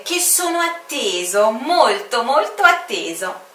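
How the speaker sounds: playful emphatic "molto atteso"; stressed repetition. Acted. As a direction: lean on "molto" both times. Aviator stresses he is awaited.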